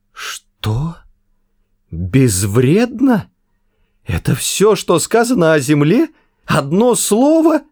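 rising, disbelieving outrage; can't believe it, voice climbing; incredulous. Acted. Arthur outraged at the entry.

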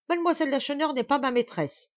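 high, loud, commanding denial; imperious, menacing. A narrator's reading. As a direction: forbid the insult, almost threatening. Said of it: "d'un ton impérieux jusqu'à la menace". (ton impérieux jusqu'à la menace)

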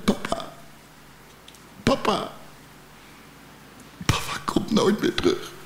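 put-on hushed, breathy whisper of a grieving child; children whispering. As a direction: whisper it like a small child who has just lost their dad. Children grieving the lost father.

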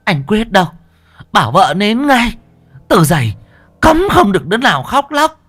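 put-on high-pitched, stern old woman's orders; sharp rebuke, rising to a forceful ban; scolding shout. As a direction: snap orders at the mourners. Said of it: "Bà cụ Đoành quát".

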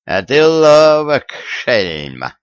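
high, loud and slow, half-amused; mock threatening. A narrator's reading. Amused tsar wags a finger at the cunning youth, mock stern. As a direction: wag a finger at a sly rascal. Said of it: "погрозил он пальцем Виталию".

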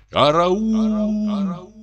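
high, long-held wail from afar; a stretched cry carried on the wind; distant cry for help. A narrator's reading. Someone in the forest cries for help, distant high wail. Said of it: "донёс явственный крик".

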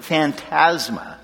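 Phantasma said slowly and softly, each syllable fan-TAS-ma drawn out; savoring the word. Shown as a demonstration. Preacher relishing the Greek word for the congregation, Phantasma slow and soft. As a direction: linger over Phantasma like a cool sounding word. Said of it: "That's sort of a cool sounding word".

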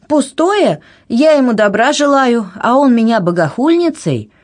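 high, outraged protest; hurt indignation. A narrator's reading. Anna complaining to her aunt of being insulted, indignant. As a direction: protest the injustice, pitch well up.

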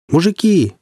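high excited call from above; anxiously joyful shout. Acted. Voice from above calls the men, anxiously joyful. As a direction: call down, anxious but glad. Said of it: "голос тревожно радостный".

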